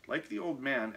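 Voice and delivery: usual speaking voice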